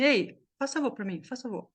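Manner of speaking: casual "faz favor" blended into "fasavô"; offhand request with "faz favor" run together; everyday run-together speech. Shown as a demonstration. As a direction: toss off "faz favor" as one linked word. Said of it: "A gente fala assim".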